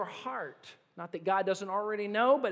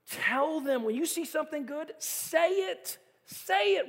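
higher, rising plea to speak up; urgent urging; 7 semitones higher than usual. Real, not acted. Preacher urging the congregation to speak encouragement, rising and pressing.

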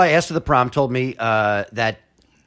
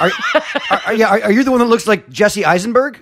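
laughing question; 6 semitones higher, 3 dB louder than usual. Real, not acted. Teasing about old photo.

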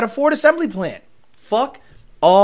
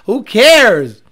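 normal talking voice

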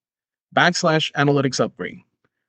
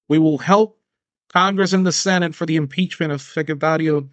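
solemn politician's plea, lightly done; hint of a pompous speech; mock campaign appeal; 3 semitones higher than usual. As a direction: urge voters like a campaign ad. Mock speech, Oscar remark.

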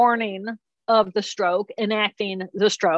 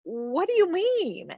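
puzzled question; 9 semitones higher, 5 dB quieter than usual. Retold or imitated. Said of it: "And I'm going".

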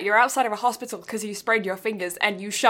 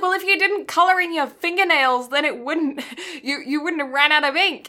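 higher, louder scolding teacher; snappy teacher; 6 semitones higher, 3 dB louder than usual. Retold or imitated. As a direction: snap at the kid like a teacher. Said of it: "your teacher snapped at you".

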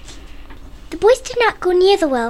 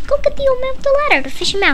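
brisk mother; 12 semitones higher, 3 dB louder, much faster than usual. Acted. Mother gives quick order.

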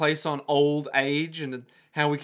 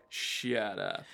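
low muttered; 7 semitones lower, 10 dB quieter than usual. Retold or imitated. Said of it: "Whole Horse is like".

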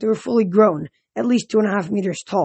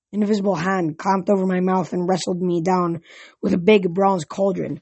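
usual speaking voice

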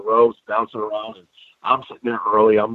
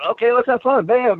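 put-on excited kids; 13 semitones higher than usual. Kids voiced joining the fun.

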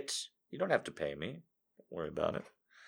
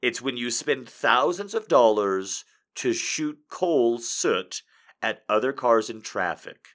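ordinary talk

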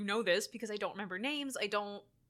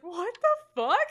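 high, loud, laughing bafflement; laughing disbelief; 7 semitones higher, 8 dB louder, slower than usual. Retold or imitated. Podcaster recalls reacting to title card, laughing disbelief. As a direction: blurt it out, amused and astonished.

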